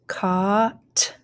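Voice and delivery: "caught" with a long held vowel, then a detached t; slow blended "caught"; blending. Shown as a demonstration. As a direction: stretch "caught" into caah, pause, t. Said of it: "start blending".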